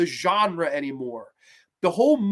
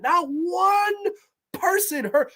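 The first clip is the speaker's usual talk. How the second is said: exasperated cry; 9 semitones higher, much slower than usual. Real, not acted. Outraged rant.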